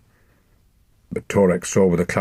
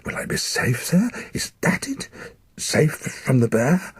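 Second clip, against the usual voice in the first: frightened questions; 7 semitones higher than usual. Acted. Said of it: "he cried".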